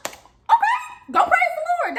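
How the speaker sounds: very high-pitched sarcastic concession; mock acceptance. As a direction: give in with exaggerated, high-voiced sarcasm. Mocking reply to friend's mom.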